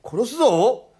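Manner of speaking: loud, high-pitched threat; threatening. Acted. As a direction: bluster as you threaten him. 刃物で脅す泥棒. (声を張って脅す)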